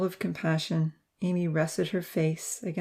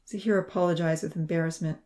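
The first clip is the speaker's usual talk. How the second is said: ordinary delivery, nothing marked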